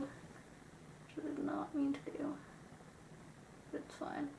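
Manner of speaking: breathy whisper to herself, barely voiced; whispered aside. Real, not acted. Vlogger whispering to herself while fixing a smudge. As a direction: murmur it under your breath as the liner smudges.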